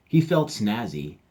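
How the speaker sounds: ordinary delivery, nothing marked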